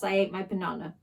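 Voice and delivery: ordinary delivery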